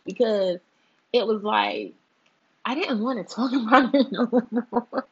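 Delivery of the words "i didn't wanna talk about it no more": laughing through the words. Real, not acted. Vlogger laughing at her own rant. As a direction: giggle the line out, louder. Laughing while repeating herself.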